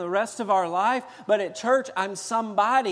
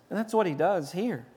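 ordinary delivery

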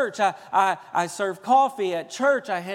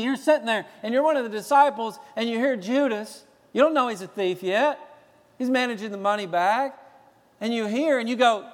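ordinary talk, nothing marked

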